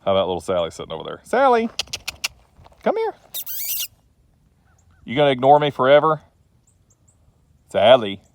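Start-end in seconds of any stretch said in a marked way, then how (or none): 1.2-8.2 high sing-song call to the dog, much higher and louder; coaxing a dog that won't come, calling high. Real, not acted. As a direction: call her over from across the yard.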